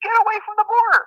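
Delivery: high, urgent mock scolding; alarmed wife. Wife pulling husband from the border, alarmed scolding. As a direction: order him back as the panicked wife.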